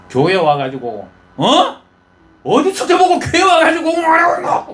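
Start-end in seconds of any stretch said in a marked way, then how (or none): 1.4-4.7 much higher, louder scolding with a laugh; scolding rebuke. Retold or imitated. Old priest Eli scolding the praying woman, higher and louder. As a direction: scold her as a drunk in church. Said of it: "그래 갖고 야단합니다".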